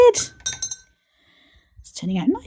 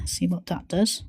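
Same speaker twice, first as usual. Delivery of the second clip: normal talking voice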